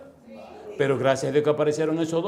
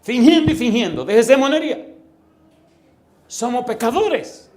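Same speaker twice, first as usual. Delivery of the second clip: loud, high pulpit shout; preacher's rebuke; 9 semitones higher, 9 dB louder, slower than usual. Real, not acted. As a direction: rebuke the flock at full force. Predicador reprende a la congregación. (reprensión a gritos desde el púlpito)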